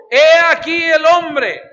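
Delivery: loud, high and slow proclamation; proclaiming loudly. Acted. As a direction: present him to the crowd with full voice. Pilate presents Jesus to crowd.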